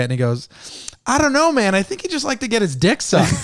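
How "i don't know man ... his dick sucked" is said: high, louder punchline voice; mock high punchline. Cousin answering why the boss helped, high mock.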